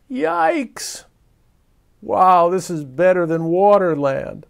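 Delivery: high, loud delight; thrilled shout. Acted. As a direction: shout it like a ride. Thrilling fall as raindrops.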